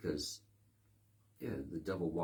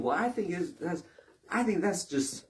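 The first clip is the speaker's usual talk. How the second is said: mock pundit; 7 semitones higher, 4 dB louder than usual. Imitates pundit opinion.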